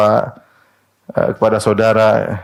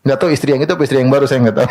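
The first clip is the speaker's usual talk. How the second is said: quick laughing aside; much faster than usual. Real, not acted. Preacher jokes about the wife.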